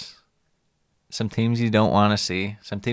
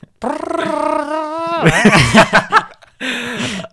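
long, loud, high burst of laughter; big laugh; 8 dB louder than usual. Real, not acted. Laughing at the mouth-trumpet joke, big loud laugh. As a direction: crack up completely.

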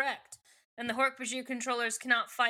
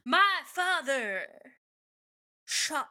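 slam poet; 3 semitones higher, 4 dB louder, slower than usual. Acted. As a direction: perform it like overwrought open-mic poetry, with weighty pauses. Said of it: "gives me slam poet".

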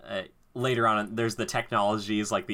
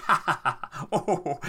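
higher, cackling evil laugh done lightly; mock villain laugh; 7 semitones higher than usual. Sidious gloating over the thrown pod, mock laugh. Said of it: "He's just like".

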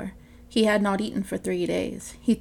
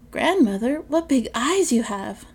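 wide-eyed wonder; 5 semitones higher, 6 dB louder than usual. Acted. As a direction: marvel at each strange feature. Girl wonders.

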